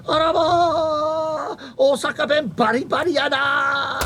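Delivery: high, slow, delighted Osaka auntie; Osaka shop lady. Retold or imitated. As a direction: crow it like an amazed Osaka auntie. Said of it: "she said".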